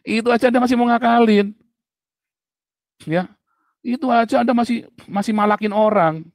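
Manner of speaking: loud, high preaching rebuke; preacher scolding his flock, loud and high; fiery rebuke. Real, not acted. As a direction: thunder it at the congregation. Pastor rebuking congregation over cheating.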